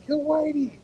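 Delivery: high-pitched mock holler, not full volume; retold yell. Retelling his junior high prank, high imitated yell. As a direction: yell it high and drawn out, holding back volume. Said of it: "I'd yell out".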